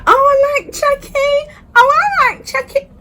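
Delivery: high, squealing excitement over Chucky; giddy fan. Retold or imitated. Narrator mocks her past self squealing high over Chucky.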